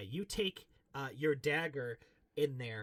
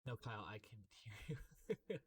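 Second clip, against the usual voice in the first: hushed whisper aside; 5 semitones lower, 13 dB quieter than usual. Real, not acted. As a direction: whisper so the stream won't catch it. Telling player he's still audible.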